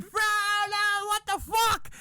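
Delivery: very high mock-hysterical outburst; freaking out. Women react to the snooker table, mock freak-out. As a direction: imitate women in total uproar. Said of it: "Women were freaking out".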